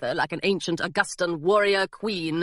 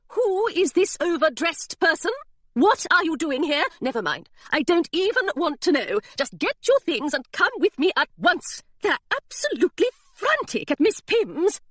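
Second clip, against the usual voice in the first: high, brisk, indignant governess; scolding governess; 8 semitones higher than usual. Acted. Governess ordering Hilary home, high and indignant. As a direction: rattle off orders with no room to argue.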